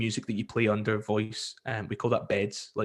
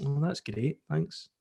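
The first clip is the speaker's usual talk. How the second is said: flat underwhelmed thanks; 3 semitones higher, 4 dB quieter than usual. Retold or imitated. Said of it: "they go".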